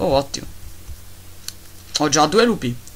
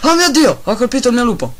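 much higher, louder cry of alarm; horrified at his own mistake; dismayed shock; 9 semitones higher, 7 dB louder than usual. Real, not acted. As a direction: gamer yelps after hitting his pet wolf. Accidentally hit his wolf.

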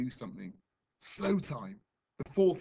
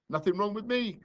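trembling; 9 semitones higher, 6 dB louder than usual. A narrator's reading. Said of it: "though she was trembling".

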